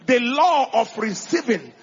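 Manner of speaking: loud, emphatic sermon delivery; preacher exhorting the church; preaching at full force. Acted. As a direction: drive each line home to the congregation.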